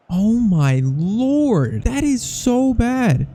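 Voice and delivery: high, slow, drawn-out groan of disbelief; aghast disbelief. Real, not acted. Gamer reacting in horror to a freak own goal, drawn-out disbelief. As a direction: stretch it out like you can't believe what you just watched.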